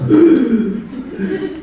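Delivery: high mock gasp for breath; imitated panting gasp. Teacher shows herself out of breath on the stairs, mock gasp. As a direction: puff like after four flights. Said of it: "I'm breathing heavy, going".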